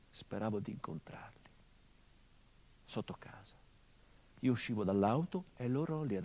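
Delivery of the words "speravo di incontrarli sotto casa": hushed whisper, almost no voice; whispered confession. Acted. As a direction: breathe it out like a secret. Confessione intima nel monologo. (sussurrato, quasi senza voce)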